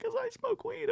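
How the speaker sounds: high, small voice; mock girl voice. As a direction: say it like a nervous young coworker. Girl quoted.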